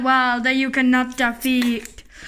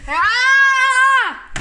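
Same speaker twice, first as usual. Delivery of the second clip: mock roar; 8 semitones higher, 5 dB louder than usual. Monster roar in play.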